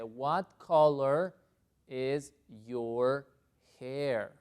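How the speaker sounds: very slow, separated words; word-by-word model. Shown as a demonstration. Teacher introducing a new question, slow and deliberate. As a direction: read it out word by word.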